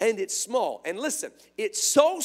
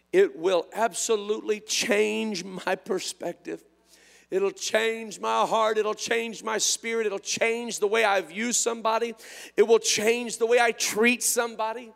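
ordinary delivery

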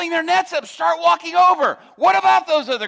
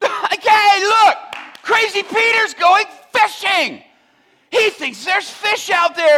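mocking, drawn-out taunt, louder; jeering; 6 semitones higher, 5 dB louder, slower than usual. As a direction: sneer at crazy Peter. Crews jeering at Peter.

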